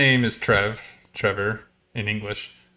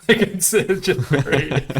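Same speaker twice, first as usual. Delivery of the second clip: laughing; 8 semitones higher than usual. Real, not acted.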